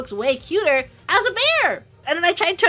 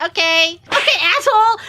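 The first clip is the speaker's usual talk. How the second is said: higher and louder, playfully insulted; mock annoyed; 4 semitones higher, 6 dB louder than usual. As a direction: snap back in fake outrage. Friend mocks her, she snaps.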